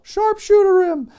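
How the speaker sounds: imitated heckler urging a wrestling hold; fan yelling. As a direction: holler it from the cheap seats. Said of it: "one fan just yell".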